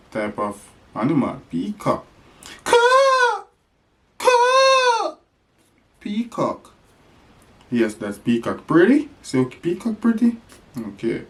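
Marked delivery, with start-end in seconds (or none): 2.6-5.2 peacock call. Shown as a demonstration. Peacock sound for toddler. 8.7-10.4 put-on talking to a toddler.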